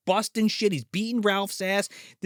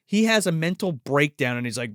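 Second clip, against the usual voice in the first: normal talking voice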